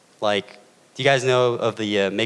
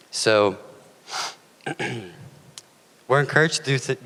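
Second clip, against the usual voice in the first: ordinary delivery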